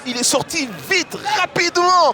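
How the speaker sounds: loud replay commentary. Real, not acted. Replay analysis over crowd.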